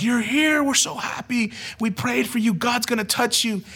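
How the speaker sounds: high-pitched imitation of gushing girls; genuinely happy welcome. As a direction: act out their delighted, heartfelt welcome. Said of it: "genuinely like".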